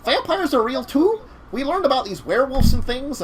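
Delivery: high, incredulous mock Minnesotan; Minnesotan accent. Cockroach man learning vampires are real, Minnesotan accent. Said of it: "I did a really good Michigan accent".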